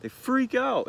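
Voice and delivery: ordinary talk, nothing marked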